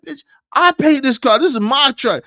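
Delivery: furious husband yelling his truck is his; angry retort. Retold or imitated. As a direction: snap back at her, outraged. Shouting back at wife.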